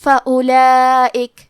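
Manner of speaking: فاولائک میں واو ظاہر نہیں، الف پیش سے، آہستہ; فاولائک کو آہستہ پڑھیں، واو کو ظاہر نہ کریں; واو ظاہر کیے بغیر. Shown as a demonstration. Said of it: "الف کو پیش سے پڑھ کے حرف اللام کو پڑھیں گے". (واو کو چھپا کر فاولائک کی آہستہ تلاوت)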